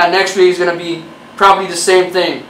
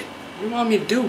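softer, fast, under-the-breath plea; muttered plea; 3 semitones higher, 10 dB quieter, faster than usual. Acted. Creator muttering at viewers, fast and soft. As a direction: mutter it quickly, half to yourself.

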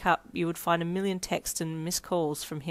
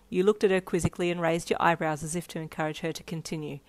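usual speaking voice

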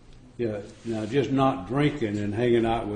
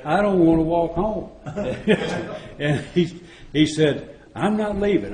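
laughing; 6 semitones higher than usual. Retold or imitated. Mock quarrel retold, laughing.

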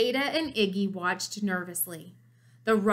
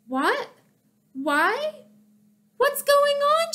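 rising, higher and higher bewildered questions; startled confusion; 4 dB louder, slower than usual. A narrator's reading. June surprised by the party, high bewildered questions. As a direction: caught completely off guard.

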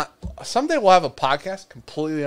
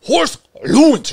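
loud, high, slow Russian accent; 11 semitones higher, 9 dB louder, slower than usual. Shown as a demonstration. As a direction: boom the name out Russian-style. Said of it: "That was Russian as shit".

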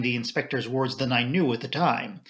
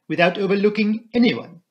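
put-on higher, louder, accented question; Poirot; 7 semitones higher, 6 dB louder than usual. A narrator's reading. Poirot double-checks with the inspector, insistent French accent.

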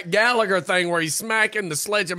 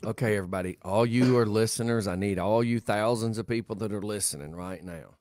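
lower, grave mock warning; mock serious; 10 semitones lower, 5 dB quieter than usual. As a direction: play it deadpan serious. Mock announcement to listeners.

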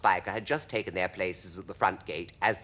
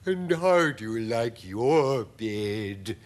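slow, drawn-out spider hostess; unhurried creature character voice; spider lady voice; 4 dB louder, much slower than usual. A narrator's reading. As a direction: ask slowly as a lady spider. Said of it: "she said to James".

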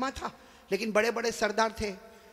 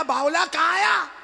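high, loud exasperated retort; scoffing shout; 9 semitones higher, 10 dB louder than usual. Acted. The chiefs shout back scornfully at the caller. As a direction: fling it back at him, fed up.

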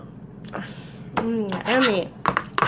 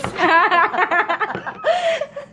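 high-pitched burst of laughter; real laughter; 8 semitones higher than usual. Real, not acted. Laughing at the feeding mishaps.

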